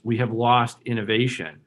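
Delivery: usual speaking voice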